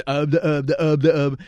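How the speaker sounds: loud, high mock sputtering; flustered stammering. Caught caller still has no answer, mock stammer. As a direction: stumble on the same syllables, imitating panic. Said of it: "And he's still just".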